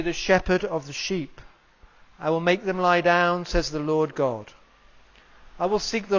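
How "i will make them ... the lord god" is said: ordinary talk, nothing marked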